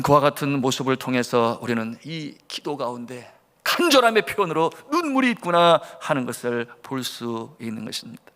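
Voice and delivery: usual speaking voice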